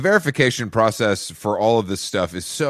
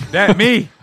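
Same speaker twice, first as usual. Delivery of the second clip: loud; 10 semitones higher, 7 dB louder than usual. Real, not acted. Jokey loud self-claim.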